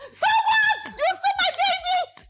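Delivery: very high, loud mock outrage; furious auntie. [name] imitating Auntie Bimbo's outrage, high and loud. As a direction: shriek like the furious guardian.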